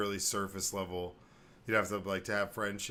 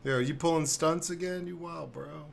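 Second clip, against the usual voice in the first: higher and louder, playful bro voice; teasing bro; 9 semitones higher, 8 dB louder than usual. Pretend. Parent jokes with a fallen kid, high and loud. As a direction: hype up the kid like a buddy.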